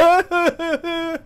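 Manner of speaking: loud, high-pitched imitated wail; hammed-up crying fit; mock bawling. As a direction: burst into exaggerated childlike sobs. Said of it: "burst into tears".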